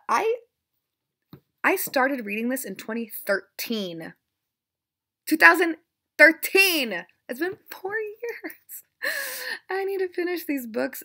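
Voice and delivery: aggressive outburst. Real, not acted. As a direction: vent loudly about years of not finishing. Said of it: "I'm getting kind of aggressive".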